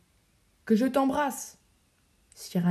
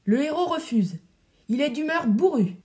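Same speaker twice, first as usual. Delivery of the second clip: thundering call; 6 semitones higher than usual. Acted. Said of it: "d'une voix de tonnerre".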